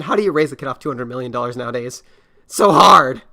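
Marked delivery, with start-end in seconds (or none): 2.5-3.2 loud, mock-sympathetic "So hard"; sarcastic. Real, not acted. Mocking the rich dad's struggle, loud and sarcastic. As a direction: lay the sarcasm on.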